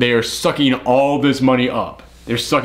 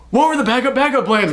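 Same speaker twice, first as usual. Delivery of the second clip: pitched way up in disbelief, what were the backup plans; incredulous question; 10 semitones higher, faster than usual. Retold or imitated. Mocking the heist crew's missing backup, high and incredulous. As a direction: ask it high and baffled, as if no one planned. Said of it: "And I thought".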